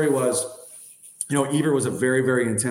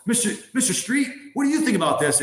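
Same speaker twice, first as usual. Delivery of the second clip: higher, mock-formal address to the captain; mock formal coach; 9 semitones higher than usual. Coach confronting the captain at lunch, mock-formal higher voice. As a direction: coach putting the captain on the spot, mockingly.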